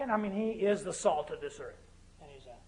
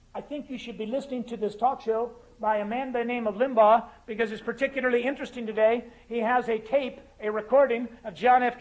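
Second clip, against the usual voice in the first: higher, louder put-on Oxfordian accent; imitated Oxfordian accent; 5 semitones higher, 9 dB louder than usual. Taxi driver recommending a talk show to his passenger, imitated Oxfordian accent. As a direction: lightly mimic a precise Oxfordian speaker. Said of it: "in perfect Oxfordian accent".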